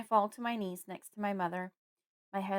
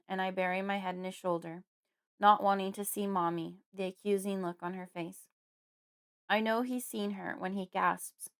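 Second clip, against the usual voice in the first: usual speaking voice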